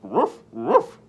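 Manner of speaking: loud woof bark; barking dog. Acted. Voicing the dog for the kids, loud barking. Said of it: "barked the dog".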